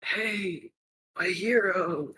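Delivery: soft, high, faint drawl; weak wounded greeting. Acted. Wounded Ladon to his rescuer, weak greeting. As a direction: greet your rescuer with little strength.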